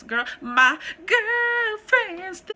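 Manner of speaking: put-on high, drawn-out sassy "girl"; slow, high, sassy sitcom catchphrase; sassy girlfriend catchphrase. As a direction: stretch it out like the sitcom friend. Imitating a Girlfriends character.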